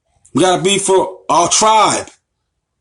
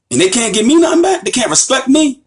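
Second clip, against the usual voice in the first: higher-pitched, aggrieved protest; indignant; 8 semitones higher than usual. Real, not acted. Indignant complaint about disrespectful newcomers.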